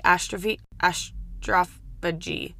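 ordinary delivery

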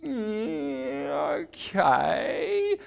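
high, reluctant oh yeah okay; grudging concession. Retold or imitated. Man in servo reluctantly concedes the point, high and grudging. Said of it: "he sort of went".

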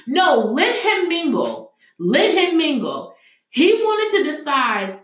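high, slow, scornful let him mingle; scornful insistence. Real, not acted. Mocking the jail's caution, slow and scornful. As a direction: dare them to let him out. Scoffing at solitary custody.

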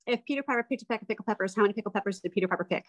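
rattled off very fast; tongue twister on request, said very fast. Shown as a demonstration. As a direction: race through the tongue twister. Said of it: "let's hear a tongue twister from you".

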